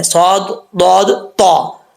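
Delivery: सौद दौद तौ drawn out slowly, thick and heavy; heavy full-mouth sounds. Shown as a demonstration. Teacher showing heavy letters to students. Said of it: "पुर पढ़ा जाएगा".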